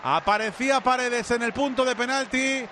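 driving commentary rising with the chance; live play-by-play. Real, not acted. Commentator calls a chance for Spain, excited live play-by-play. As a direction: build as the cross comes in, stretch the near miss.

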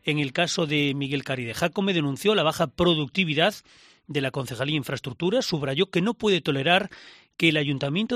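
projected radio newsreader delivery; lively, punchy news bulletin voice; news read. Real, not acted. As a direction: read out the headline story with emphatic phrasing. Anchor reads the news item.

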